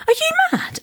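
very high, disbelieving squeal; high-pitched mock outrage; retold incredulity. Retold shocked refusal of a proposal.

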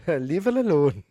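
slow, half-laughed attempt at Welsh; badly faked, drawn-out Welsh accent; terrible Welsh accent. Co-host asked for Welsh on the spot, terrible Welsh accent. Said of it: "Killer, do a Welsh accent".

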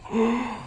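sharp, high startled gasp. Retold or imitated. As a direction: catch your breath in shock. Witch sees Samuel rise.

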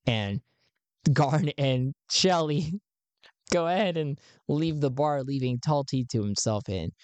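ordinary delivery

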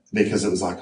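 usual speaking voice

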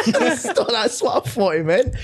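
can barely get it out for laughing; helpless laughter. Real, not acted. Cracking up at himself in front of mates, laughing.

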